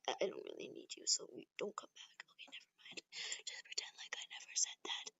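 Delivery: very soft, voiceless whisper; secretive whisper. Acted. Taking back the request to come back, whispering.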